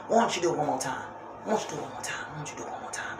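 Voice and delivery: hushed tempting devil voice, fading to a whisper. Retold or imitated. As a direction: coax softly, sinking into an unvoiced whisper.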